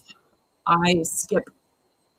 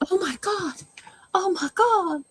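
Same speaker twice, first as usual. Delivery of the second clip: put-on much higher, swooning Southern Belle accent; 12 semitones higher than usual. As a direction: gasp it like a fainting Southern Belle. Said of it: "Southern Belle or something".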